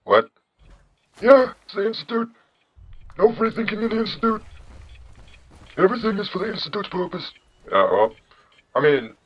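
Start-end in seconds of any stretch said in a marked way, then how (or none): 1.2-7.3 put-on high old-man caricature; John Henry. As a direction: recite the dogma in an old-man falsetto. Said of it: "you have the generic old man voice".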